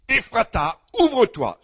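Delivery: Ephrata, ouvre-toi proclaimed high and loud; loud healing command. Retold or imitated. Preacher retelling his prayer over a deaf woman, loud commanding proclamation. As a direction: command the ears to open with full force.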